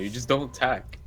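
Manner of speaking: ordinary delivery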